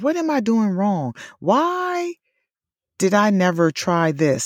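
high-pitched mock exasperation; self-questioning. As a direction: wail it lightly, pitching way up on WHY. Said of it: "That's often my response".

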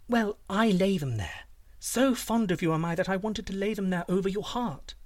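high, gushing princess voice; doting princess. Acted. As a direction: flatter the giant sweetly, pitched high.

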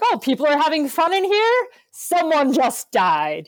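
high, incredulous question slowing into a heavy verdict; scandalised family killjoy, put on; mock indignation. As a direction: act out an offended relative killing the fun. Mimics family policing the mood.

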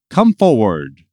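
loud, firm command; loud call across a crowd; priest's summons. A narrator's reading. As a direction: order him up to the front. Priest summons the older man.

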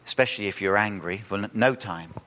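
ordinary talk, nothing marked

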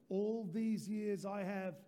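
slow, deliberate words for the church to echo; slow, steady reading; slow call-and-response. Shown as a demonstration. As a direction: say it slowly so they can join in. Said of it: "let's do it one more time".

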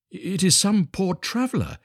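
high, slow musing; concerned little Hans. A narrator's reading. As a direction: say it to yourself, pitched high. Hans hears knocking, talks to himself.

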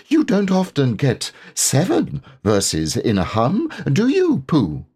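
little piglet, pretending not to care. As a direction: squeak it trying to sound casual. Piglet acting careless.

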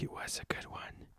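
unvoiced whispered aside; quick whispered afterthought. Real, not acted. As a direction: whisper it to yourself. Whispered aside closing podcast.

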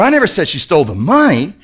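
"money" punched high, falling at the end; emphatic final "money"; stress on MONEY. Shown as a demonstration. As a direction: save all the weight for "money". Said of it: "it all depends on how you say it".

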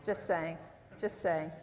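low, dry throwaway; low-pitched joking aside; deadpan aside. Real, not acted. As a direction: drop it low as a wry afterthought. Deadpan aside after a joke.

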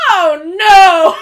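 very loud, high mock wail; imitated wail of dismay. Podcaster imitating Eric's reaction to the film, loud wailing dismay. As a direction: cry out in hammed-up horror. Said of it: "And Eric went".

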